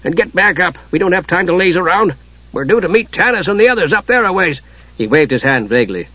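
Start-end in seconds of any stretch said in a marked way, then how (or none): 0.0-4.6 higher-pitched, impatient dwarf; worked-up dwarf. A narrator's reading. Dwarf orders the lazing Kender up, impatiently. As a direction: nag him to get moving. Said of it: "The dwarf was breathing heavily".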